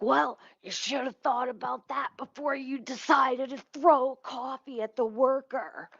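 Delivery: snide officer, slowing at the end; mock cop voice. Imitating the cop who cuffed her, slowed and mocking. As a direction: mimic the arresting cop's smug comeback. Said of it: "He's like".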